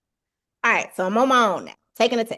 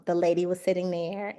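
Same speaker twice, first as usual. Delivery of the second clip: usual speaking voice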